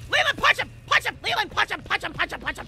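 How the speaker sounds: high, loud frantic pleading; loud desperate urging; urgent yelling. Real, not acted. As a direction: yell at your teammate to fight. Urging teammate during fight.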